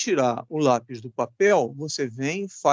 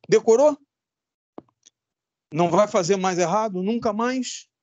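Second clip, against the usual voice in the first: quizzing the class; 5 semitones higher than usual. Real, not acted.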